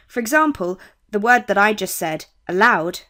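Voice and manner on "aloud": aloud with LOUD hit hard and "a" light; stress on second syllable. Shown as a demonstration. Teacher showing learners what is a stressed syllable, stress on the "loud" of "aloud". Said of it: "I emphasised the loud part".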